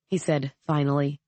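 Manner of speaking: ordinary delivery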